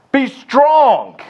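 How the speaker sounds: high, loud command; rally cry. Shown as a demonstration. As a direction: bark it to the team. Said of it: "It's a rally cry".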